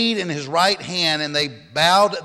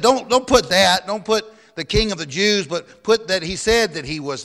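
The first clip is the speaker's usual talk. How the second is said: raised, urgent protest; insistent objection; 6 semitones higher than usual. A narrator's reading. People protest to Pilate about the title, insistently. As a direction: object firmly, pitch lifted.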